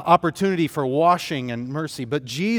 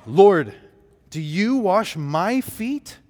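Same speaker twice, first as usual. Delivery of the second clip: "Lord" called out sharply, then a slow, higher question; astonished disbelief as Peter; incredulous question; 4 semitones higher, 4 dB louder, slower than usual. Acted. Preacher voicing Peter, shocked that Jesus would wash him, incredulous.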